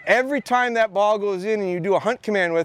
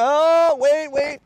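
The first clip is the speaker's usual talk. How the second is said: excited call; 6 semitones higher, much slower than usual. Retold or imitated. Retold excited call to dog.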